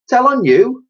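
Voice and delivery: confused urchin asking back; befuddled girl. Acted. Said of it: "replied Elsie, befuddled".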